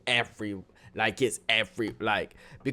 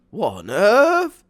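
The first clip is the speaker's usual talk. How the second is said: higher, louder and slow, drawn-out disbelief; incredulous; 8 semitones higher, 7 dB louder, slower than usual. Real, not acted. Reading about avoiding responsibility, slow incredulous disbelief. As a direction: stretch it out, baffled.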